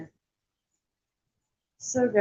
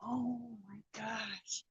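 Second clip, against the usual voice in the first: breathy hushed oh my gosh; whispered gasp; 16 dB quieter, slower than usual. Real, not acted. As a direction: whisper it so the cats stay asleep. Whispering over her sleeping cats.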